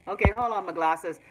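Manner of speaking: usual speaking voice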